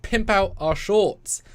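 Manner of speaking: high, loud, slow hype call; rally the audience like a showman; excited calling out. Real, not acted. Host urging Stuart to promote shorts, loud hype.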